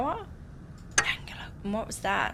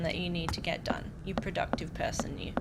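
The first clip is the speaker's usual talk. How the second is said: whisper; 5 semitones lower, 9 dB quieter, faster than usual. Real, not acted. Whispered farewell to viewer.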